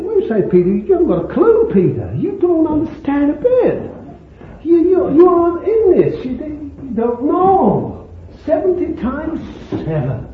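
high, exasperated retort in preaching voice; Jesus chiding Peter. Retold or imitated. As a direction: scold the clueless disciple, pitch leaping up. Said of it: "He said, I said".

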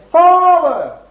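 high, loud cry upward; shouted call. Retold or imitated. Jesus calling up to his Father in heaven, shouted. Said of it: "that's where he's shouting".

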